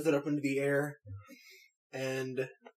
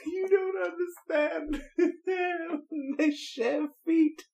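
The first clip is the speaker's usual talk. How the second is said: high, slow words through laughter; laughing hard. Real, not acted. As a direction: explain it while cracking up. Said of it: "I might be laughing a little hard".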